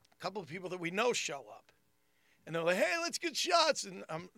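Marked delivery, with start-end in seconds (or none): 2.8-3.8 high, stretched-out rallying cry; excited party invite. Retold or imitated. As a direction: pitch it way up, rounding up friends for drinks. Friends arrive wanting shots.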